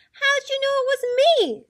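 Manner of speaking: high, loud, groaned complaint; groaning turkey. A narrator's reading. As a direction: groan loudly as a found-out turkey. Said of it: "groaned the turkey".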